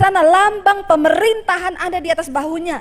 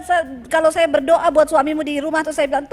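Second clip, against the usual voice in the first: ordinary talk, nothing marked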